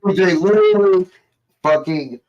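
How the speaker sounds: sudden high, loud burst; outraged. Real, not acted. Telling friend about arrest, outraged. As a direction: jump way up in disbelief.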